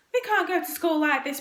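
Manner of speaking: put-on high, loud boyish protest. A narrator's reading. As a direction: object indignantly like a schoolboy. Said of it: "protested Tom".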